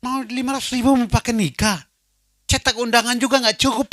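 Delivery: high, loud scolding yell. Retold or imitated. Fiancée yells that the money won't even cover invitations. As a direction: shout it at him in disbelief. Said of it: "Dia berteriak".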